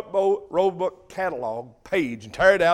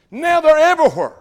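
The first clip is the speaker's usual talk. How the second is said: high, loud exclamation; preaching peak; 7 semitones higher, 8 dB louder than usual. Acted. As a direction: throw it out to the congregation.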